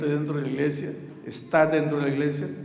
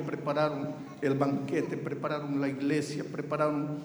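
normal talking voice

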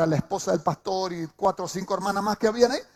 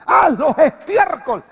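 slow, loud, higher command on Haz; commanding shout; 8 semitones higher, 10 dB louder, slower than usual. Shown as a demonstration. Preacher booming David's order to the congregation, slow and loud. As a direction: bark out Haz los estiércol like a king's order. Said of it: "No, Pablo, David dice".

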